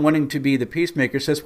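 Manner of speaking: ordinary talk, nothing marked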